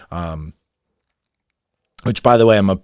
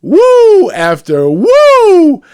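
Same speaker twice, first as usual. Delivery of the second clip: much higher, louder, drawn-out cheer; hyped woo; 12 dB louder, much slower than usual. Real, not acted. Host hyping his show's woos, drawn-out excited cheer. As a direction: stretch it out like a crowd cheer.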